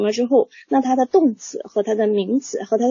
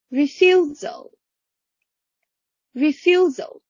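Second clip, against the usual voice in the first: each word called out high and clear for class to repeat; leading a read-along; 6 semitones higher than usual. Shown as a demonstration. Teacher leads class in reading each word three times. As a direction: call out each word like a drill leader. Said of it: "每一个单字来一起读，读三遍啊".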